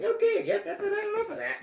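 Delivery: very high mimicked voice; imitated scolding. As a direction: put on a high voice to rein someone in. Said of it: "That usually means".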